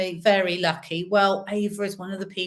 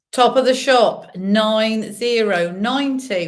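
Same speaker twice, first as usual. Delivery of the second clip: bingo caller; 6 dB louder, slower than usual. Acted. Bingo calling numbers.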